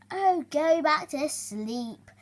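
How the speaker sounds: slow, loud snap; snapping. Acted. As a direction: snap at him. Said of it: "snapped Shrew".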